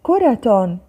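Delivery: كرة read with a final -tun; tanween damm. Shown as a demonstration. Teacher reading out the word list, tanween damm on كرة. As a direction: sound out كرة as "kuratun". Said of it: "ضم".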